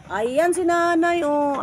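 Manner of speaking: excited high call to grandma. Real, not acted. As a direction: call out to her from a distance. Spots grandma up the rocks.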